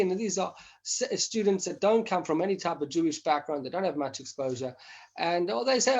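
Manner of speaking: ordinary delivery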